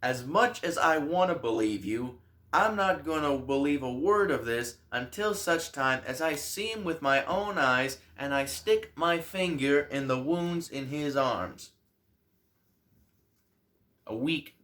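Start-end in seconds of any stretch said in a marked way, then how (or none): 0.0-11.7 loud, high, scornful disbelief; scoffing doubter. Acted. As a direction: scoff at the story like doubting Thomas. Doubting Thomas voiced scoffing.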